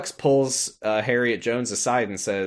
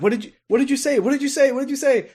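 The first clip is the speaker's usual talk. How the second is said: high-pitched repeated demand; frantic questioning; 13 semitones higher, faster than usual. Retold or imitated. Retelling Harriet desperate to know what was whispered, high and frantic. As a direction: act out rising panic in a high voice.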